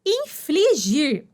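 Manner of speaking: slow, distinct "Infligir" with a clear L; careful infligir. Shown as a demonstration. Teacher introducing infligir's meaning, slow and careful. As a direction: say "Infligir" slowly, stressing the L. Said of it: "aplicar pena".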